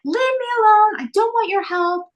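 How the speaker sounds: high, loud whiny brush-off; high petulant whine to her father; sulky teen. Retold or imitated. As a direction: push dad away like a stroppy teenager. Refusing dad's help.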